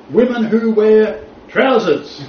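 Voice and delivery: high, loud, slow hammed-up horror; mock outraged condemnation. Teacher imitating the article's list of sinners, mock outrage. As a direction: draw it out like a scandalised preacher.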